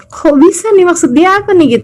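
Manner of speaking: high, baffled asking; puzzled surprise. Retold or imitated. Preacher recalling her amazement at the wife's answer, high puzzled. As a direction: sound like you can't believe what you heard.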